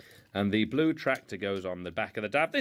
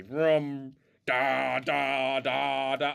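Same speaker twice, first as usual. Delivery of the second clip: rolling noises; 3 semitones higher, 4 dB louder, much slower than usual. Pretend. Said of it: "you can see how it rolls along now".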